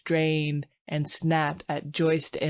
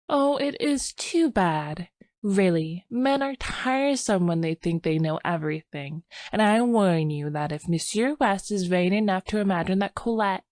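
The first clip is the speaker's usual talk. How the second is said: put-on lightly raised, gentle young wife voice; young woman; 4 semitones higher than usual. Sylvia's voice.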